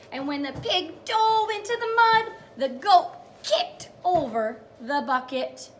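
put-on a teacher reading the big moment to young children; storytime reading. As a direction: sing out the page to the kids. Reads storybook aloud to kids.

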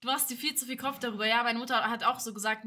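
normal talking voice

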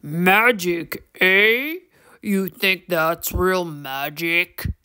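low, slow gravelly creature voice; teasing gravel-voiced troll, slow and low; deep, gravelly troll. A narrator's reading. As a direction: drawl it out deep and rough, like an old troll. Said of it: "deep and gravelly voice".

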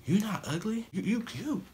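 put-on high, teasing girly voice; girls' voice. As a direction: mimic a girl's sweet reassurance, high-pitched. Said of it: "some of y'all girls out there may say".

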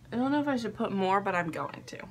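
normal talking voice

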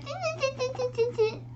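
tiny high-pitched monkey chatter; mock him in quick, soft squeaky bursts. Monkeys chatter back at peddler.

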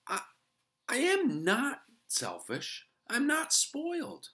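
high, slow squeaky mouse protest; insisting mouse voice. A narrator's reading. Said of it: "he insisted".

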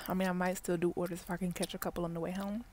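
ordinary delivery

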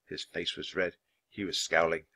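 usual speaking voice

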